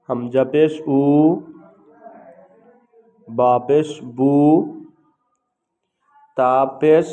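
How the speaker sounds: loud, slow, measured syllables with pauses between; letter-by-letter recitation drill. Shown as a demonstration. Teacher showing learners how to pronounce pesh, in a loud recitation drill. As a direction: call out each letter and its vowel slowly and loudly.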